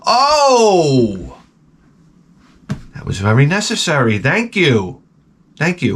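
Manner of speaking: mock the jeering coworkers; sarcastic coworkers. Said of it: "you hear people go".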